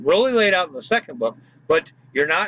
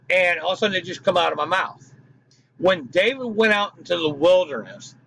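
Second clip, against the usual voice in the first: usual speaking voice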